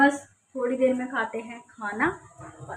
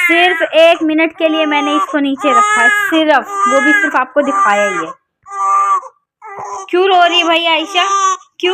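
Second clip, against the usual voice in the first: raised voice; 5 semitones higher, 8 dB louder than usual. Real, not acted. Baby crying loudly, mother talks over. (रोते बच्चे के शोर में ज़ोर से बोलना)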